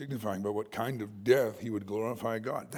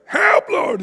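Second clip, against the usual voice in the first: high, loud cry for help; panicked cry; 14 dB louder than usual. Retold or imitated. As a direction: yelp as if sinking. Peter sinking, calling out.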